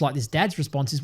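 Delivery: normal talking voice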